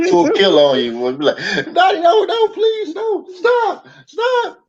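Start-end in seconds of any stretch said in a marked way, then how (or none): 1.7-4.6 very high, hammed-up panicked begging; mock pleading. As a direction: squeal it like a pretend victim begging for mercy. Mock victim begging Nadia to stop.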